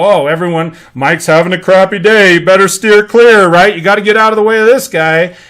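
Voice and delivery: loud, hammed-up warning to everyone; mock gossip. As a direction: spread the gossip loudly, like a mock alarm. Coworkers gossiping about grumpy boss.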